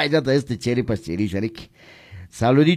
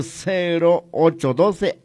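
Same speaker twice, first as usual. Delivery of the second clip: slow dictation; 4 semitones higher, slower than usual. Shown as a demonstration. Phone number repeated for dictation.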